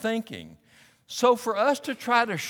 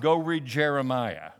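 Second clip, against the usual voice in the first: ordinary talk, nothing marked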